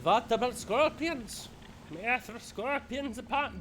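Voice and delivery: slow, high mock German accent. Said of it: "what brand of German do you want from me".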